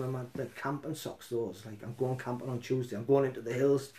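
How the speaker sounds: ordinary delivery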